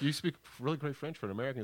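quick, high, impressed praise; impressed French venue staff. Retold or imitated. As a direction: gush like a delighted French promoter.

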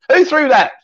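high, laughing mock outrage; laughing film quote. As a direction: blurt it out through a laugh. Acting Life of Brian, laughing.

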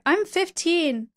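put-on high, slow, drawn-out girlish claim; put-on fifteen-year-old. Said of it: "And I'm like".

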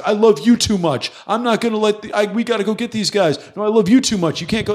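high-pitched imitation of a tearful argument; retold emotional pleading. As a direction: plead back and forth, acting it out. Acting tearful cops and workers arguing.

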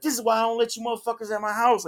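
high-pitched retold scolding; snapping. Little brother scolding guests in his house, retold snapping. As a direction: imitate someone laying into his guests. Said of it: "he's snapping on him".

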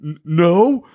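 loud, high, stammered no; sputtering loud refusal; indignant no. Retold or imitated. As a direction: blurt the no out in outrage. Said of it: "I am like".

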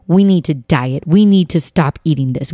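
normal talking voice

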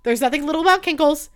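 loud, high indignant outburst; mock outrage. Indignant rant about cankles.

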